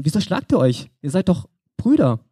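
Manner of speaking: usual speaking voice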